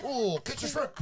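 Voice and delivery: high mock chef calling out; hibachi chef. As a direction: play the showman tossing shrimp. Imitating hibachi chef's tricks.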